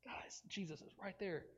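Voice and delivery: hushed conspiratorial whisper. Acted. Demons plot quietly near Jesus, hushed whisper. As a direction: whisper so Jesus won't hear.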